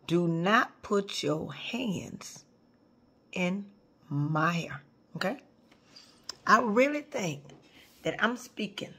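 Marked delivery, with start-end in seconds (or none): 0.1-5.4 slow, hushed and low, every word spaced; whispered warning. Real, not acted. Speaker warning the audience about her hair, hushed and slow. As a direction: lean in and warn them in a hush.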